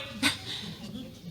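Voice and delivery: short airy laugh; a quick unvoiced chuckle; breathless laugh. Laughs after refusing ride.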